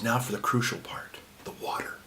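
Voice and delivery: whispered like a sacred secret; retold priest whispering over the water, hushed and solemn; mock solemn whisper. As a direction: hush it, the crucial step.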